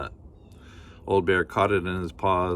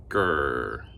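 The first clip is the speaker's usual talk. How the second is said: low bear growl; 6 semitones lower than usual. Acted.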